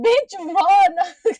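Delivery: high, loud yell of "run"; loud high shout at the TV; yelling at the screen. Retold or imitated. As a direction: scream a warning at a horror character. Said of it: "I was like".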